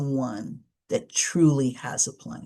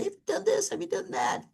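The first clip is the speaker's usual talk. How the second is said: high, softer sing-song questions; lightly mimicked busybody; mock pestering suggestions; 12 semitones higher, 7 dB quieter than usual. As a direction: pepper them with little suggestions. Acts out unhelpful suggestion-giver.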